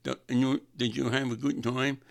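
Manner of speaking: mimicking a date's harelip voice; copying her impediment. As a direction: ask it in a borrowed impediment. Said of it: "I talked like her".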